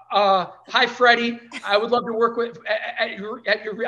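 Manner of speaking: nervous, eager, tripping over words; rushed, stammering eagerness; stumbling over words. Retold or imitated. Starstruck young woman stumbling over words to her hero. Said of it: "I was, like, stumbling over my words".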